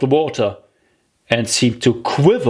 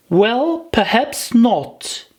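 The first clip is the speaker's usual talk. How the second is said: put-on higher, slow, gentle girlish give; soothing; 11 semitones higher, slower than usual. Alice calming the angry mouse, soothing and slow. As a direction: soften it to calm the mouse. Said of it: "in a soothing tone".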